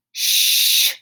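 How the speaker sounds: sharp loud hush; hushing shh. Acted. Mother hushing her noisy son. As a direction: shush him hard. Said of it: "called his mother".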